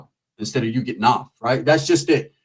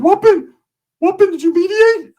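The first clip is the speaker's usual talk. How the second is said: high, loud eager voice; excited cousin; 6 dB louder than usual. Retold or imitated. Cousin asking eagerly if it got sorted. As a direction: call out eagerly. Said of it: "he's like".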